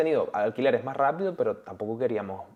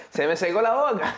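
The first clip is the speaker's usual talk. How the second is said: laughing; 8 semitones higher, 4 dB louder than usual. Real, not acted. Laughs about dry mouth.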